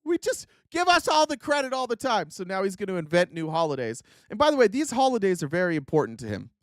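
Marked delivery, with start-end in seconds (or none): 0.0-2.3 hammed-up angry complaint, pitched way up; Donald Trump, mock mad. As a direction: whine in mock outrage about being left out. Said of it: "Donald's just mad that other people".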